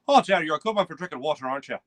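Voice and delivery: quick, badly faked Irish lilt; terrible Irish accent. Irish people complimenting his friend, in a bad Irish accent. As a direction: put on a hammy Irish accent. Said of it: "Pardon my accent. It's terrible".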